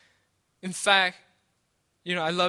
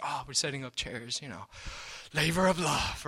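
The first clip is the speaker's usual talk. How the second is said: airy, put-on churchy sweetness; mock fake piety; 4 semitones lower, 7 dB quieter than usual. Preacher mocking fake servants, in a mock pious voice. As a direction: mimic a show-off volunteer lightly. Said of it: "it's like".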